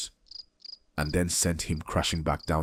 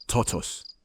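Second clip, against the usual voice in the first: shouted name; 5 semitones higher than usual. A narrator's reading. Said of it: "He shouted to his wife".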